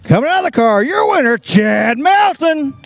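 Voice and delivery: loud, rising announcer call; announcer shout. Real, not acted. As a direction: call out the winner big. Announcer calling out winner.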